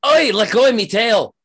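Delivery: put-on high, loud accented raccoon yelp; shriek. Raccoon grabbed by the tail, shrieking at the player. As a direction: shriek in indignant protest. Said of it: "the raccoon shrieks".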